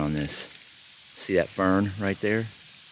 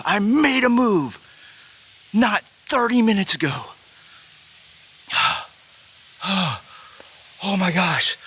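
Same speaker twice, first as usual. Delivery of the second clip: high, heavy-sighing, trembling voice; breathless excitement; 11 semitones higher than usual. Real, not acted. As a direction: catch your breath between gasps of disbelief. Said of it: "I gotta calm down".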